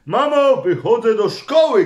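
put-on loud, high call of a boy running off to school; shouted call. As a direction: shout it to mom from the doorway. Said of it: "krzyknął". (głośne, wysokie wołanie chłopca do mamy)

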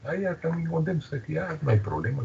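lightly mimicked happy friend; imitating a friend. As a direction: echo the friend's pleased reply up high and soft. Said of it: "Me dice".

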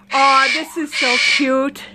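ordinary talk, nothing marked

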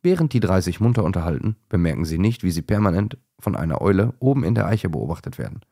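plain reading voice

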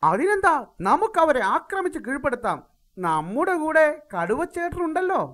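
high, fast and boastful; cocky cartoon animal. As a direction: brag in a squeaky voice.

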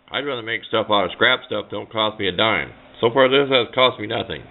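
ordinary talk, nothing marked

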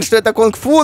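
normal talking voice

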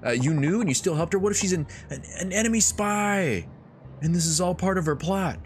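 high, quick flustered voice; worried Yuki. Acted. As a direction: fret about a spy, rushed and high. Yuki panicking about spy.

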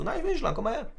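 high-pitched retold coaxing; high, placating "come here"; coaxing reassurance. Coaxing a kid to come over.

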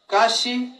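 Kashi said with a rising tone; Kashi, meaning hard, on a rising tune; Mixtec tone demo. Shown as a demonstration. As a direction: let Kashi climb at the end. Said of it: "pero en su entonación ya, ya cambia".

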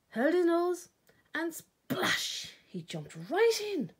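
excited climax. A narrator's reading. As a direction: leap in with the words. The big jump into the pool.

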